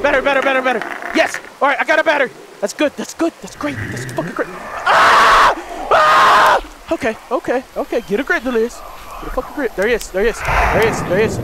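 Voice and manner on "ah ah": sudden high scream in fright; startled scream. Real, not acted. Gamer jump-scared by a monster, screaming high and loud. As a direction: shriek as the monster jumps out.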